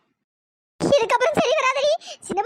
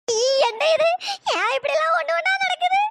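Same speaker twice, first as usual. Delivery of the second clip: normal talking voice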